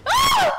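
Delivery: huge high shriek of fright; startled scream. Real, not acted. Gamer jump-scared by the baby, screaming. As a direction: shriek in terror.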